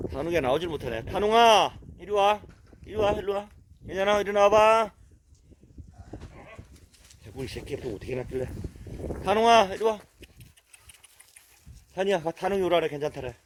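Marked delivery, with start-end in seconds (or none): 1.0-4.9 high, loud coaxing call to a dog. Real, not acted. Owner calling his hiding dog to come out, loud and high. 9.2-10.0 high, loud coaxing call to a dog. Real, not acted. Owner calling his hiding dog to come out, loud and high.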